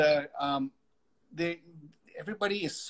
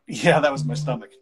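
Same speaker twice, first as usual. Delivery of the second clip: sheepish laugh; 8 dB louder than usual. Retold or imitated. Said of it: "And I went".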